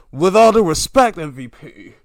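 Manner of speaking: hammed-up arrogant boss; mock MJF. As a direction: ham up a smug condescending heel. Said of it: "M J F tries to be like".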